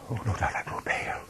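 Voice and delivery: hushed, fast whispered aside. Acted. Narrator admitting he grew pale, fast frightened whisper.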